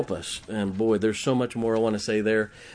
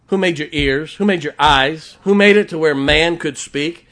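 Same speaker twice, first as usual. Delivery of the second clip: ringing, mounting rhetorical questions; loud preaching challenge; 3 semitones higher, 9 dB louder than usual. Acted. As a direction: thunder each question as God challenging Moses. Preacher voices God's rebuke to Moses.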